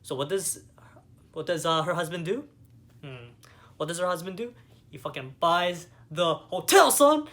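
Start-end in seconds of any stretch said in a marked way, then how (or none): 4.9-7.3 hyped-up shout, son! cracking high; gleeful, much louder shout; excited. Real, not acted. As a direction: yell it like the best plot twist ever. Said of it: "That was so sick".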